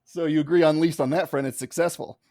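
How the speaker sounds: usual speaking voice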